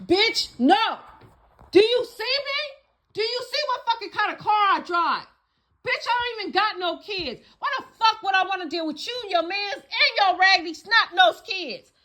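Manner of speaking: high, loud, scornful retort; indignant comeback. Retold or imitated. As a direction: snap back like an insulted diva, high and loud. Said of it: "That's what they really be thinking".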